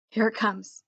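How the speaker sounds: usual speaking voice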